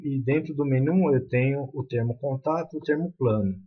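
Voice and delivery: ordinary talk, nothing marked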